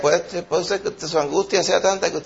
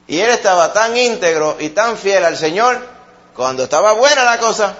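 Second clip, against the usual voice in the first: raised, ringing preacher voice; forceful preaching; 4 semitones higher, 4 dB louder than usual. Acted. Pastor challenging the congregation, preaching at full force. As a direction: drive the challenge home to the congregation.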